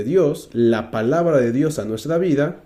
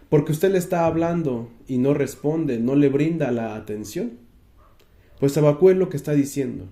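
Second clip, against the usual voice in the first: ordinary talk, nothing marked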